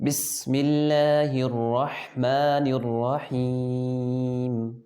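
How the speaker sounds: very slow, measured tajweed recitation; Quran recitation. Shown as a demonstration. Teacher models Bismillah for pupils to copy, slow recitation. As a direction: hold the long vowels, drawing out the ending. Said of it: "آپ نے میرے پیچھے repeat کرنا ہے".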